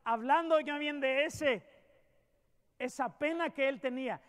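fervent pulpit delivery; preaching at full force. Acted. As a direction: drive each phrase home to the flock. Preacher building up the sermon.

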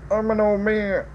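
put-on old man voice. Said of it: "I can't do an old man accent".

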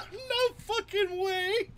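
very high, loud, slow squeal; real cracking-up amazement, words stretched high; laughing disbelief. Real, not acted. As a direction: shriek it out through laughter. Cracking up at friend's news.

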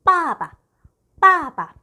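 teacher modelling 빠바; Chinese tone demo. Shown as a demonstration. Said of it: "아빠는".

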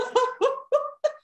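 long, high rolling laugh; long real laugh. Real, not acted. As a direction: let the laugh run on, high. Still laughing.

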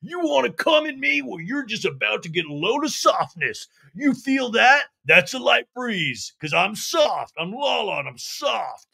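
high, loud hammed-up brawler voice; mock Lala tough talk. Host imitating Lala challenging Katie to a cage match, high and loud. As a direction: puff up and threaten. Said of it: "And Lala's like".

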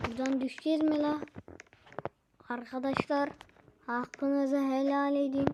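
ordinary talk, nothing marked

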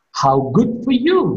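mock admiration climbing high on "you"; sarcastic. Shown as a demonstration. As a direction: say it as mockery, lifting "you". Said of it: "I am making a sarcasm".

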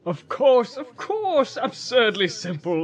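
high, delighted burst, settling as it goes; gleeful relief, starting high then easing down; laughing exclamation. A narrator's reading. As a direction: laugh at your own foolishness. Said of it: "He was laughing".

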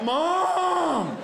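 put-on high, loud childish wail; mock kid yelling for mom; lame cry for mom. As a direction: wail for your mother like a kid. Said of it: "So lame".

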